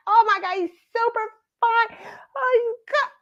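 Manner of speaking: high-pitched mock gushing; hammed-up swoon over a crush; retold swooning. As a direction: gush like a smitten fan, voice way up. Retold gushing over handsome singer.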